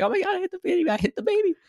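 very high mock-hysterical squeal; freaking out. Podcast host imitating the actress who thinks she hit a baby, hysterical. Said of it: "she's still freaking out".

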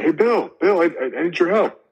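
high, urgent call to a friend passing by; calling out for help. Retold or imitated. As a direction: shout up to your buddy for help from the hole. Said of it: "And he's like".

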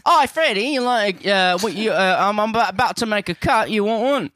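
put-on chirpy British teen voice, offering a cuppa; British accent, teen offering tea; British teenager accent. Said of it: "impression of a British teenager offering you tea".

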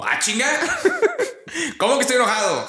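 high, laughing mock outrage at being called angry; laughing disbelief. Retelling his reaction when told people think he's angry, laughing disbelief. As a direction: burst out in amused disbelief, voice jumping up. Retold own reaction at party.

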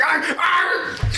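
high shouted barking yells with a laugh in them; angry bark. Retold or imitated. Tony barking at his friend over his sister, high mock bark. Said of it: "He just fucking barks".

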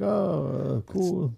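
imitating a tongue-tied, high-pitched reply; starstruck. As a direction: act out a hesitant, starstruck fan. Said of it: "I still couldn't talk at this point".

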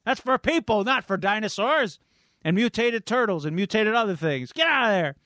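high-pitched exasperated shooing; mock angry yelling. Storyteller as Raph shooing pepperoni-eating pet, mock angry yell. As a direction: act out an outraged yell to chase someone off.

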